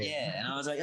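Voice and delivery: normal talking voice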